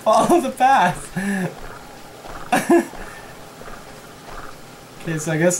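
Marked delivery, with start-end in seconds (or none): 0.0-2.9 slow, high giggly words; talking through laughter; laughing. Real, not acted. Laughing at the friend.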